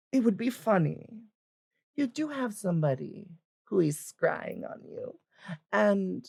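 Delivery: high, halting, deliberate character voice; hesitant older woman picking her words; old adventurer woman. Acted. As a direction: deliver a warning reluctantly, with long pauses. Old adventuress gives halting warning.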